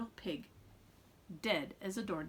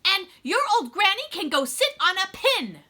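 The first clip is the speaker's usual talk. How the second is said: higher, louder taunting shout; yelling; 7 semitones higher, 8 dB louder than usual. Retold or imitated. Pig insults the wolf's granny, loud mock yell. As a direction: hurl the insult about granny. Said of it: "pig yelled".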